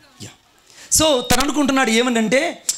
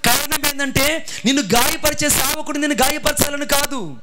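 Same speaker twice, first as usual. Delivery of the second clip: raised, building sermon voice; preaching; 4 semitones higher than usual. Acted. Pastor preaching the lesson to his church, rising fervour. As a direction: preach the point to the congregation.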